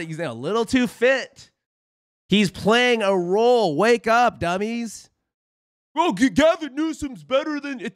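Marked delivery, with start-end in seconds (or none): 5.9-7.8 mock Democrat voter. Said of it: "mocking Democrat voter".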